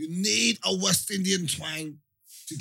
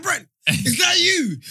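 high, loud call of "Brent, is that you?"; shouting, as if spotting a friend online; excited call; 10 semitones higher, 6 dB louder than usual. Retold or imitated. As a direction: call out to him, thrilled. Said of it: "I was like".

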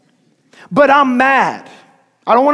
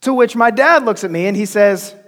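ordinary talk

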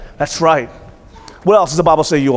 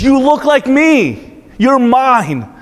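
higher, slow, fond voice of God; God speaking; 7 semitones higher, much slower than usual. Retold or imitated. Preacher voicing God to his congregation, higher and slow. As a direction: speak it like a father claiming his child. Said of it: "God looks at you and he says".

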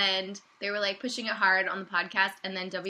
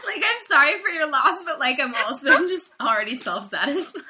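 laughing; 6 semitones higher, 7 dB louder than usual. Real, not acted. Gloating, laughing.

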